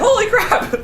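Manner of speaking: high laughing yelp of shock; startled yelp. Acted. Man fleeing the exploding booth, yelping high in shock. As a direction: squeal it as the booth blows up.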